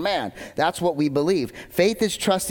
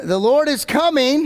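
high, loud proclaiming cry; prophet's shout; 9 semitones higher, 5 dB louder than usual. Retold or imitated. John the Baptist crying out to the crowds, in a loud high shout. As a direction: call it out like a street prophet. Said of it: "and he shouted".

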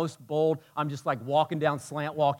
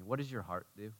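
ordinary talk, nothing marked

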